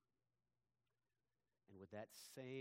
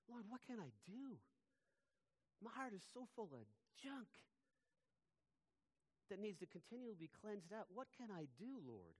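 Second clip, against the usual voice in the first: pleading prayer; 9 semitones higher than usual. Retold or imitated. Retold personal prayer to God.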